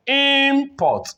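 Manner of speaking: import with IM held high and long; stress on first syllable. Shown as a demonstration. Teacher introducing the noun, first-syllable stress on import. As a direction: punch the IM of import. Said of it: "is on the first syllable".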